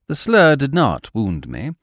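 usual speaking voice

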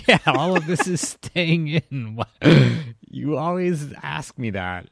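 loud, high, through real laughter; laughing. Real, not acted. Host answers whether it stays in, laughing loudly. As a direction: crack up while answering. Cracking up at co-host's question.

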